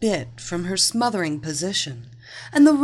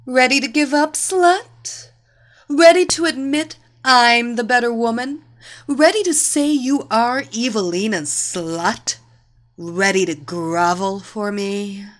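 high, loud taunting questions; triumphant, pressing taunt; gloating demand; 4 semitones higher, 5 dB louder than usual. A narrator's reading. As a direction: goad a loser into surrender. Victor demands a surrender.

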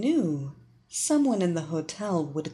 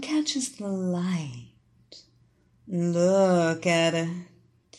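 slow, hushed hypnotist voice; hypnotic voice; slower than usual. Acted. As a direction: lull her in, very slowly. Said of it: "Evelina's hypnotic voice caressed her mind".

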